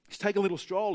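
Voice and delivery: ordinary delivery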